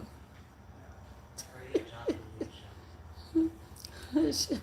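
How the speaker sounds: long breathy laugh; amused cackle, real. Real, not acted. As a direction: laugh at the rant you just watched. Laughs after seeing her rant.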